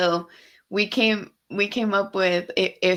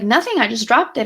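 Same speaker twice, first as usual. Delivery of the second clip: imitating mom, high and louder; higher, indignant brush-off; defensive denial; 4 semitones higher, 6 dB louder than usual. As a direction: protest innocence in a mock-mom voice.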